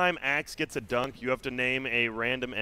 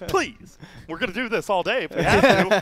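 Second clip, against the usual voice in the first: mock director; 8 semitones higher, 8 dB louder than usual. Mock director demanding retakes.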